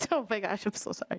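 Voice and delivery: breathless, flustered apology under the breath; embarrassed near-whisper. Real, not acted. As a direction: whisper it, caught off guard. Flustered after stumbling over words.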